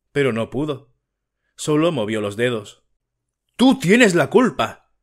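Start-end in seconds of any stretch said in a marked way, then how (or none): 3.6-4.9 louder, higher, slow accusing yell; husband yelling at his wife; shouting blame. A narrator's reading. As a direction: lay the blame on the wife at full voice. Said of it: "le gritó Siriayev".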